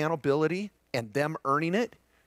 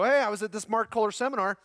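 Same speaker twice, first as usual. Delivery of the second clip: lightly voiced parent, higher, breezy and upbeat; mock parent; 7 semitones higher than usual. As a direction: play the eager parent fresh from the seminar. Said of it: "have that intervention with your kids".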